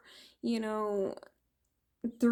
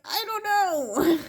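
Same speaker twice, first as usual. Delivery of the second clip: high, louder rising I don't know; high helpless reply; 10 semitones higher, 5 dB louder than usual. Retold or imitated. She answers the alien, high helpless. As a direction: toss it up helplessly.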